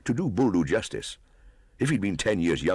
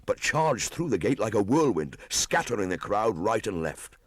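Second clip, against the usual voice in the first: ordinary delivery, nothing marked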